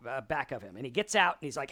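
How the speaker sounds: usual speaking voice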